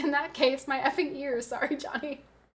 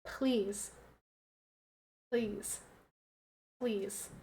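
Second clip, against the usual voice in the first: quiet pleading; 4 semitones lower, 13 dB quieter, much slower than usual. Real, not acted. Begging softer and softer.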